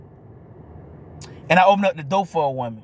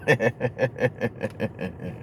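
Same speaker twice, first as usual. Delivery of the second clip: long, low staccato snicker; soft, even run of chuckles; chuckling; 7 semitones lower, 7 dB quieter than usual. Real, not acted. Laughs after asking about anger.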